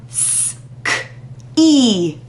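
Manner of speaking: ski chopped into sounds, slowly; teacher chopping the word; segmented sounds. Shown as a demonstration. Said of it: "chop up".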